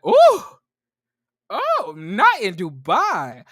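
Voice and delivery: clutch your pearls, scandalized; hammed-up gasp of disapproval; mock shock. Mock reaction to cussing in Dubai.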